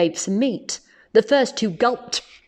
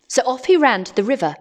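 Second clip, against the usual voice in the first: ordinary delivery, nothing marked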